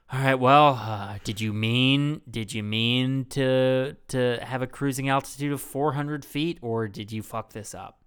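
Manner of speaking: passive-aggressive. Retold or imitated. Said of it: "a lot of passive aggression".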